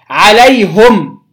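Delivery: عليهم said "alayhum", u after h; slow عليهم, closing on bare m after "hu"; damma on ha. Shown as a demonstration. Teacher showing students a new form, عليهم as "alayhum". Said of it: "مع ضم الهاء".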